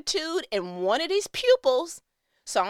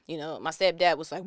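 ordinary talk

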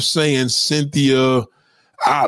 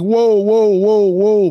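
higher, mock-alarmed string of whoas; retold alarmed interruption; 5 semitones higher, 4 dB louder, slower than usual. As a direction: cut in to stop an oversharer. Acts out stopping an oversharer.

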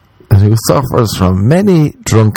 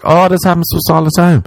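higher-pitched, quick imitation of women; a raised mock voice for complaining women; imitating women; 9 semitones higher, faster than usual. Imitating women's reaction to the bit, higher and quick. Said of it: "women are gonna be like".

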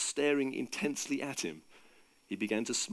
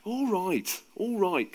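higher-pitched giving in; reluctant, pitched-up surrender; conceding; 7 semitones higher, much slower than usual. A narrator's reading. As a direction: give in to nagging friends. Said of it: "conceded Jesus".

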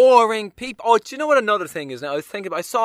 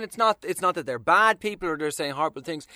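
ordinary talk, nothing marked

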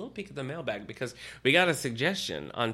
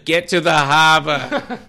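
loud, slow, laughing mock call; hammed-up swoon; 5 semitones higher, 15 dB louder, slower than usual. Host calls his imagined Boston lover, loud and laughing. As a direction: drag it out loud through a laugh.

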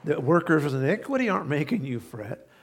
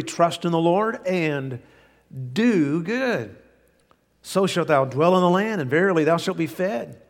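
louder, raised, measured reading; scripture reading; 3 dB louder than usual. Acted. Preacher reading the verse to the congregation, louder and measured. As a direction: proclaim the verse.

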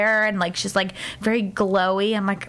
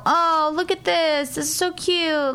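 high, cooing imitation of a wife; mocking gushing; 6 semitones higher than usual. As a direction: gush in a put-on higher voice. Husband mimics wife's gushing.

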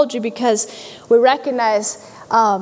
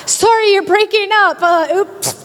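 higher mock phone excuse; fake bad connection; 6 semitones higher than usual. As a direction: pretend the call is cutting out.

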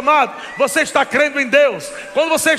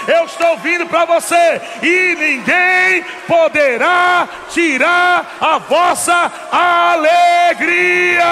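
slow, high, louder proclamation; preaching at full force; 5 semitones higher, 3 dB louder, much slower than usual. Acted. Preacher quoting Jesus to the church, slow ringing cry. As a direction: drag out every word.

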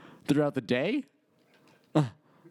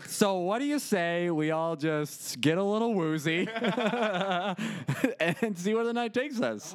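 smooth pickup line; 6 semitones higher than usual. Pretend.

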